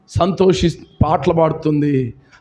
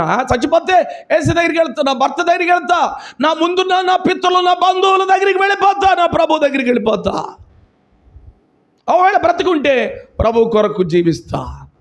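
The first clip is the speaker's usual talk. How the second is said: high, ringing, building to a shout; exultant vow; 9 semitones higher than usual. Acted. Preacher voicing the widow's vow to go to the Lord, high and exultant. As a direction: declare it with fearless joy.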